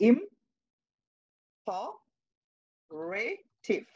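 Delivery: ইম-, প-, রে-, টিভ broken into separate syllables. Shown as a demonstration. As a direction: split ইম্পারেটিভ into ইম, প, রে, টিভ with pauses. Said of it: "sir তোমাদেরকে সবসময় বলবো".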